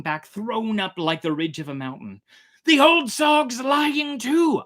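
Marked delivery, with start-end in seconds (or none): 2.6-4.6 high, loud, slow triumphant shout; exultant cry. A narrator's reading. Narrator crying out to his crew, exultant high shout. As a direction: crow it out in triumph. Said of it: "I cried exultingly".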